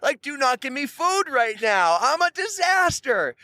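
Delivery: high-pitched panicked thoughts about being handed food; panicked inner voice. Retold or imitated. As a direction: squeal it like he's mortified.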